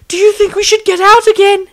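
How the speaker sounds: high, loud, frightened plea; spilling fear. Acted. Rysandria asking the group to flee, high and frightened. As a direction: voice jumps up in panic as the door closes. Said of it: "allowed fear to spill over".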